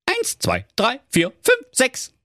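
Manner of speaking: high counting voice, climbing; kids counting. Acted. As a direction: count off like little goats. Zählten durch.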